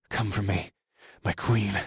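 softly growled command; low growl. A narrator's reading. Husband urging on his queen, soft growl. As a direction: growl it quietly to your beloved. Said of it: "I growled".

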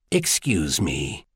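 low, soft and unhurried; softly murmured aside. A narrator's reading. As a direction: excuse yourself in a slow, discreet murmur. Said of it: "she murmured to her current conversational partner".

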